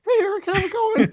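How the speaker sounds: teasing cohost about his high voice, squeaky mock greeting; impression of the cohost. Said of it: "that's how you sound to me every time we talk".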